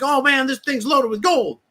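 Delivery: high, excited mock gasp of greed; excited investor. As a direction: sell the fake thrill of finding gold. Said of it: "They're like".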